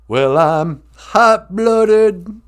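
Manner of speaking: stretched-out playful swagger; playful boast. Pretend. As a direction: ham up the brag. Host brags playfully to puppet.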